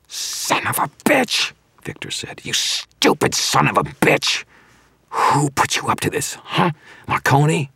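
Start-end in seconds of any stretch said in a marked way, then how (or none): 0.1-1.5 strained, high hissing curse; seething, drawn-out outburst; furious curse. A narrator's reading. Villain cursing in furious outburst. 2.4-7.7 high, strained angry demand; enraged villain demanding answers; furious raging. A narrator's reading.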